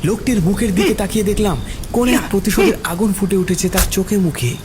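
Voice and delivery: plain reading voice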